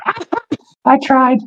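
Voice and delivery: put-on high-pitched old crone voice; high, cackly granny; cackling old woman. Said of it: "this cackling old woman be like".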